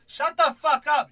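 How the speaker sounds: slow, high, very loud shout; booming retold outburst; mock parental yell. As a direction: yell each word at the kid. Quoting a yell at a son.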